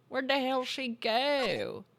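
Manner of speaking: slow, hammed-up drawl; mock drawling accent. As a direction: stretch it out in a silly mock accent. Repeats own line in silly voice.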